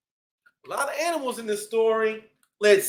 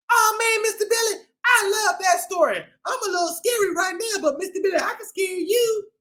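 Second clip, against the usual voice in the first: high puppet bee voice; Busy the Bee voice; 7 semitones higher, faster than usual. Acted. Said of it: "Busy the Bee, what do you think".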